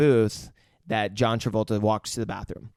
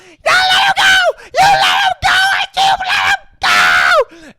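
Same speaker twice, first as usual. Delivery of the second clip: shrieking far higher and louder, frantic; hysterical screaming; 12 dB louder, slower than usual. Retold or imitated. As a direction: scream it in total panic, last line slowed. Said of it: "Honey Bunny is flipping out".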